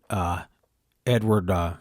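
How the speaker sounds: usual speaking voice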